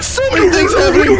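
loud, high, rushed shriek; frantic high-pitched shouting; panicked scream. Real, not acted. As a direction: scream the words out in real panic. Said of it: "That was a three-part scream".

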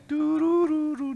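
high, slow eerie hum like a horror film tune; spooky hum. Pretend. Preacher humming a horror film tune for effect, eerie. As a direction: hum the scary movie music.

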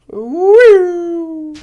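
high loud cheer; excited whoop. Real, not acted. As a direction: whoop in triumph.

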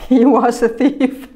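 chuckling through the words; laughing at the slip. Real, not acted. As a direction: giggle at your own mistake.

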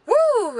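high, loud "woo"; whoop. Real, not acted. Vlogger fresh from a hot chaotic event, high whoop. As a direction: let out a high whoop of relief.

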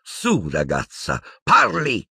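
high, taunting voice, then sharp command; taunt, coax slowly, then order; gloating villainess. A narrator's reading. Umbridge pressing Hermione for an answer, high gloating taunt. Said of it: "gongolò la Umbridge".

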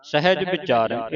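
ordinary talk

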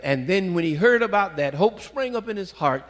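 usual speaking voice

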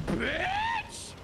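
sharp high yell; raging gamer. Real, not acted. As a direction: spit it out in anger. Angry outburst after horse died.

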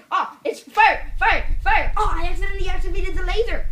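high and loud, mock alarm; panicked shouting. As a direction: yell it like the thing caught fire. Fake fire panic in skit.

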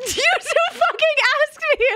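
very high, squeaky laughing voice; cracking up. Real, not acted. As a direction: squeeze the words out through real laughter. Cracking up at her own story.

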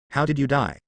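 muffled, held-in voice. A narrator's reading. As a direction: ask it muffled, suppressing anger. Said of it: "asked in a muffled voice".

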